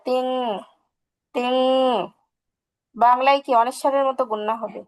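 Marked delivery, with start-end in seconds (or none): 0.1-1.5 long humming end on তিং; anusvara sound. Shown as a demonstration. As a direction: hold the "ng" of তিং in the nose.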